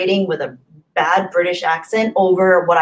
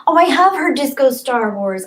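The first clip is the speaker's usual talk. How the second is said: high, loud sudden recognition; excited realisation; 6 semitones higher, 4 dB louder than usual. Real, not acted. Streamer remembering the song, excitedly. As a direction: blurt it as it clicks.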